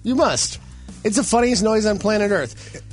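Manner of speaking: ordinary talk, nothing marked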